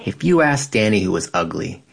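usual speaking voice

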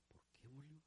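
very soft private muttering; hushed complaint. Acted. Deacon complaining about a weeping brother, hushed grumble.

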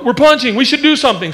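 high, eager panic; voiced feet. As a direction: talk as nervous feet. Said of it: "My feet go".

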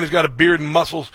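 usual speaking voice

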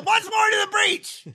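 high, far-carrying theatrical shout; Henry V battle cry. Pretend. Mock-heroic actor rallying friends to battle, big stage shout. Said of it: "I don't know if Henry Five is a".

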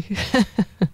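light amused giggle; real laugh. Real, not acted. Psychic to Ellie about her worst drawing, giggling. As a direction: laugh at your own botched effort.

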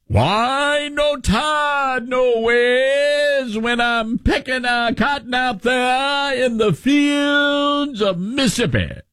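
high, slow, drawn-out fake Southern accent; mock Southern Hillary Clinton. Talk show host mocking Hillary Clinton's fake Southern accent. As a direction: ham up a phony Southern drawl. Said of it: "And that's Hillary Clinton".

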